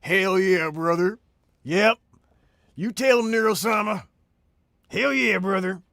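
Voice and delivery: loud hammed-up American cheerleading; boisterous put-on "hell yeah, brother" voice; rowdy American patriot. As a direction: whoop it up like a mock patriot.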